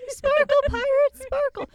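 very high, loud squealed chant; gleeful squeal. Pretend. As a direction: squeal it like a giddy kid. Silly chant at podcast end.

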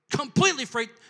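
ordinary talk

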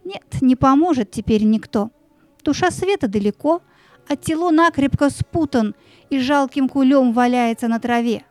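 plain reading voice

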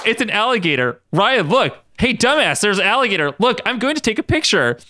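high-pitched excited calling; crying out. A narrator's reading. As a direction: urgently point it out to a friend. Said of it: "Katie cried out".

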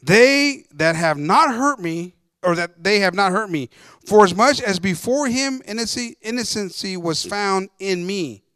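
loud, slow, emphatic declamation; preacher's scripture reading. A narrator's reading. Reads scripture aloud to congregation.

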